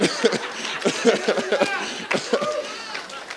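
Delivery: high airy laugh; giddy laugh. Real, not acted. Laughs after Okay coach.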